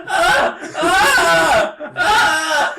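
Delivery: high, loud laughing yelps; loud high-pitched "Ah!" screams; mock kid's yelling. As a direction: imitate a screaming kid, cracking up. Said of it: "like, yelling".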